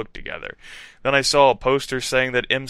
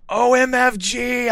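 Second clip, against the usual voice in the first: high, slow, swooning exclamation; awed; 12 semitones higher, 4 dB louder than usual. A narrator's reading. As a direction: gasp it out starstruck. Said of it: "I said after he was finished".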